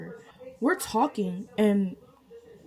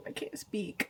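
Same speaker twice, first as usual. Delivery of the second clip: whispered, half-swallowed aside; whispered joke aside; 11 dB quieter than usual. Real, not acted. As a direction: drop to a breath.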